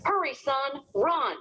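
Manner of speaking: high urgent call from a distance; a lightly voiced mother, calling up high and urgent. A narrator's reading. Mama calling her son away from the bear, urgent high call. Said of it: "I heard Mama calling".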